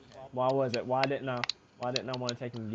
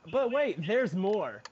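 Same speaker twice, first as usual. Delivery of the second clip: infomercial voice; 7 semitones higher, 4 dB louder, much slower than usual. Pretend. Infomercial catchphrase.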